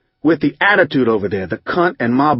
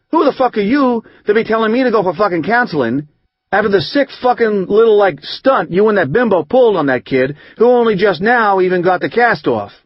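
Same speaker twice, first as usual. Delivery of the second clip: higher, strained voice of the sister ranting; sister's angry tirade; 6 semitones higher than usual. Retold or imitated. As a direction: rant at him through the screen door, pitched up. Sister screaming back at door.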